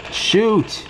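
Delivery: high urgent cry; urgent shout. Real, not acted. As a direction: bark it out in a panic. Urgent call during a firefight.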